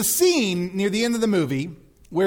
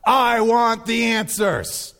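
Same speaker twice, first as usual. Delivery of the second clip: raised, insistent mock plea; people demanding answers; 4 semitones higher than usual. The people saying it to God, raised demanding cry. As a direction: call up to God, pitched high. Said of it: "the people are saying".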